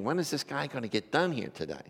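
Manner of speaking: a soft, muttered inner grumble; impatient thought. Retold or imitated. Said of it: "you're thinking".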